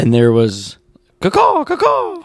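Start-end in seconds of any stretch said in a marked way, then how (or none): 1.2-2.2 high shrill caw, caw crow call; shrill screeching bird woman. Retold or imitated. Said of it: "What'd that sound like?".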